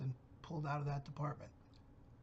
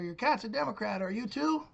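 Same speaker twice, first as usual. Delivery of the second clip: retold yell; 5 semitones higher, 4 dB louder than usual. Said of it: "I yell to her".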